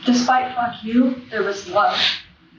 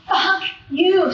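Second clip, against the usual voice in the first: retold scream at God; 8 semitones higher than usual. Grieving speaker retelling yelling at God in the street, mild mock scream. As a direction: hurl it upward like a scream, but relive it lightly. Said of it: "screaming at the top of my lungs".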